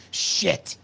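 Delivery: breathy whispered swear under the breath; whispered curse. Retold or imitated. Rookie cop realizing he missed the radio call, whispered curse. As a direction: hiss it like a rookie caught out. Realizing the mistake too late.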